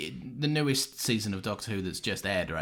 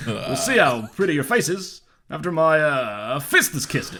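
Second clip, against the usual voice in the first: higher, loud mock-menacing line; tough-guy threat; 4 semitones higher, 7 dB louder than usual. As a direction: play a hammed-up movie tough guy.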